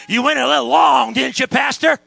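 loud, high imitation of a griping churchgoer; mock complaint. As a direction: throw the complaint out mockingly. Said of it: "all they want to say on the way out is".

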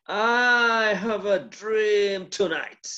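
slow, drawn-out orator's line; Martin Luther King. Retold or imitated. Preacher imitating a slow, drawn oration. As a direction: stretch "I have a dream tonight" like a great orator. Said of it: "if you are Martin Luther King and you say".

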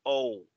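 O as a falling "ou" glide; English letter O. Shown as a demonstration. Teacher showing the sound, O as English "ou". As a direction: say O like English "oh", closing on u. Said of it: "se pronuncia con ese sonido".

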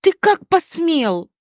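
slow, high-pitched angry accusation; acted indignant fury; hissed outrage. A narrator's reading. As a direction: draw it out in outrage. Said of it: "прошипела она".

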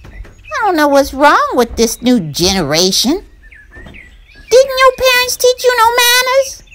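indignant rising high and loud; scold, climbing up high; annoyed. Acted. Burr Rabbit scolding the Tar Baby's manners, high and annoyed. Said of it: "getting kind of annoyed".

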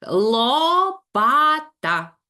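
«лопата» very slow, loud, syllable by syllable. Shown as a demonstration. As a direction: chant «ло-па-та» slowly. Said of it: "інтонаційно розділяючи склади". (повільно по складах «ло-па-та»)

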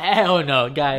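loud flat refusal; emphatic refusal. Real, not acted. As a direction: shut it down loudly. Refusing to lend his car.

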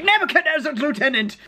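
high-pitched mock-grand proclamation; hammed-up archbishop back at his curse; declaiming archbishop. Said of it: "then he's like".

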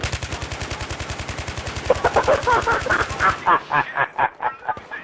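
wheezy silent laughter; breathless laugh. Real, not acted. Gamer wheezes with laughter after the chaos. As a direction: laugh helplessly with no voice.

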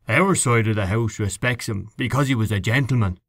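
plain reading voice

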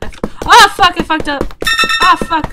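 real loud, high outburst of frustration; sudden loud cursing at a failed move; frustrated yell. Real, not acted. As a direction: shout it out as the run falls apart. Gamer messes up in play.

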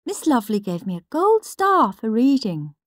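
prim little brother. Acted. Peter's diary entries read out as a prim, smug little boy. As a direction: read it like a proud little swot.

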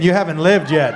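ordinary delivery

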